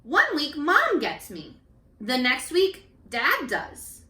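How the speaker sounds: gentle storybook reading; reading to children. A narrator's reading. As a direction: read each child's page to the class. Teacher reads picture book aloud.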